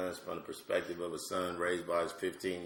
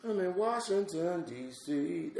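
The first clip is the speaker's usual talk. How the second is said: mimicking [name]; 10 semitones higher than usual. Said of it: "just a mimic".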